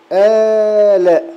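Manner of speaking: آلاء stretched slowly with the long a held; long madd badal. Shown as a demonstration. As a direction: drag out the a in آلاء. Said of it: "هذا مد بدل".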